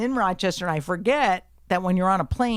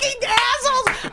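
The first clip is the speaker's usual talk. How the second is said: high, loud outburst; furious shout; 6 dB louder than usual. Retold or imitated. Passenger shouting back at the couple in front, furious. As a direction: yell the insult in outrage.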